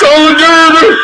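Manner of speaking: put-on high, loud laughing drunk echo; laughing mock slur. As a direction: laugh through the mocked word. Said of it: "I'll do it in solidarity".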